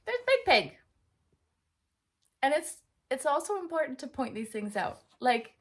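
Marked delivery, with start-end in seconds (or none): 0.0-0.8 much higher and louder, thrilled; overdone excitement. Shown as a demonstration. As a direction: gush it like a children's show host. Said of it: "you can overdo your excitement".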